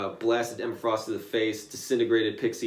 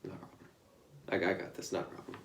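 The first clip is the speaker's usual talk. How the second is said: cool Taskmaster; 5 semitones lower, 13 dB quieter than usual. Retold or imitated. Taskmaster accepting the job, quiet and cocky. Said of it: "Taskmaster's like".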